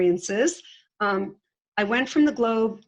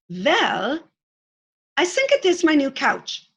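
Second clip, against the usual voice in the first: higher, louder mock Czech accent; thick Czech accent; 6 semitones higher, 4 dB louder than usual. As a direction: imitate a teenage Czech player's accent. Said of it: "in her very thick Czechoslovakian accent".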